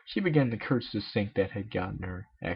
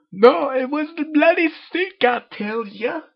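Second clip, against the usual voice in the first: higher, louder, slow hammy accusation; hammed-up blame; 11 dB louder than usual. Rainbow blames the sink to Miss Cake, hammed-up. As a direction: blame the sink like a stage villain.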